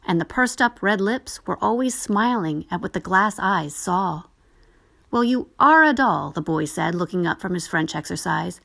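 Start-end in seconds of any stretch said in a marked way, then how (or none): 5.1-6.3 raised, louder schoolboy tease; a boy mocking his sister's doll; boy's voice. A narrator's reading. Boy teases the doll at his French exercise, raised and louder.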